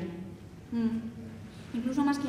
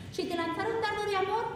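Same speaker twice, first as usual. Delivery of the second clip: higher-pitched, measured verse recitation; mock poem recital; 5 semitones higher than usual. Boys recite teasing verse to her sister, mock-poetic recital. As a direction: recite it like a suitor's crude little poem. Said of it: "qué poemas te recitaban".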